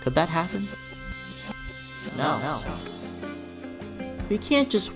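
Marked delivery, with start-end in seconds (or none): none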